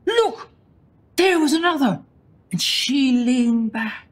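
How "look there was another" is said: high excited shout; a boy's high-pitched yell of delight; child's shout. A narrator's reading. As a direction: call out to the others, pointing at the sky. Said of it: "And Jackie Caffrey shouted".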